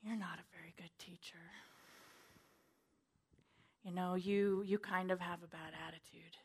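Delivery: whispered taunting accusation; enemy's whisper. Retold or imitated. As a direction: hiss it like a sly tempter in her ear. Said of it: "the enemy would whisper".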